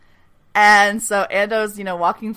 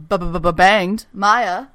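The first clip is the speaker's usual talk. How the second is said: comic stammer; 4 dB louder, much slower than usual. Real, not acted. Comic stutter before risque word.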